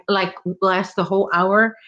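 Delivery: usual speaking voice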